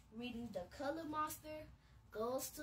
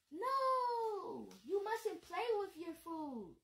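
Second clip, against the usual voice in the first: much higher, louder playful scold; teasing no-no; mock scolding; 7 semitones higher, 3 dB louder, slower than usual. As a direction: tell him off like a silly grown-up. Scolding monster at lunch.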